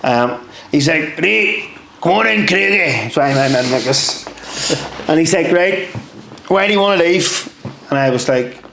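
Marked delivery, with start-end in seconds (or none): 1.2-3.1 high-pitched, hammed-up Scottish corporal; horrible Scottish accent. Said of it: "most horrible Scottish accent here". 5.5-7.5 higher-pitched mock Scottish questioning; bad Scottish accent. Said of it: "most horrible Scottish accent here".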